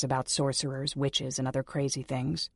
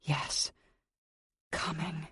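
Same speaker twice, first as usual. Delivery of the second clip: soft breathy coming; 7 dB quieter, much slower than usual. Acted. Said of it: "Coming for the bastard".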